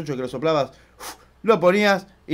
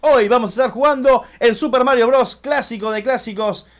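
high, loud, punchy channel-intro voice; YouTube intro announcer; 8 semitones higher, 7 dB louder than usual. Acted. Gamer greeting his channel's viewers, loud, high announcer energy. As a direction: hype up the viewers like a TV host.

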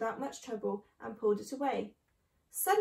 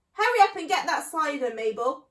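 loud, higher woman's call; bossy summons to the maid; 3 semitones higher, 9 dB louder than usual. Acted. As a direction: call through the house impatiently. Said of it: "woman's voice calling out".

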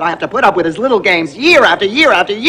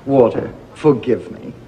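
much softer and lower, tender; sweet apology; 8 semitones lower, 5 dB quieter than usual. Acted. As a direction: coo it apologetically. Sweet false apology to husband.

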